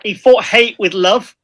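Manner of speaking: ordinary talk, nothing marked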